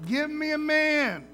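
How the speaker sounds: a mocking giant calling out the enemy camp; Goliath taunting. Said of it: "Just taunting them".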